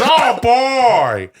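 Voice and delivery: big drawn-out actor impression; De Niro doing Al Pacino. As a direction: belt out the catchphrase as a hammy impression. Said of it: "Al Pacino says".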